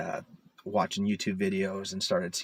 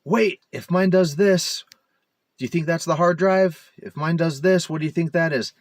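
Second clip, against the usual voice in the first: imitating confused commenters; 7 semitones higher, 5 dB louder than usual. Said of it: "in the comments is saying".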